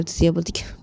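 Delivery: plain reading voice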